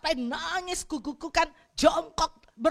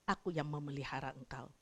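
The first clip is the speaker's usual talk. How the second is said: soft voice of God; 9 semitones lower, 7 dB quieter than usual. Retold or imitated. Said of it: "Dan Tuhan ngomong".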